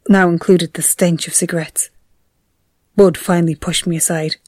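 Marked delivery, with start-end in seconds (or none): none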